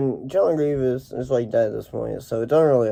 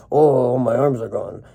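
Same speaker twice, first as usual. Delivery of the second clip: slow, drawn-out mock wail; mock lament. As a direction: draw out a hammy cry of dismay. Voices character losing his arms.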